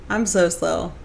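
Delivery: ordinary talk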